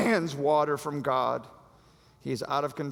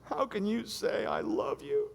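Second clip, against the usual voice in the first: mock hurt Delilah; 8 semitones higher than usual. Delilah accusing Samson.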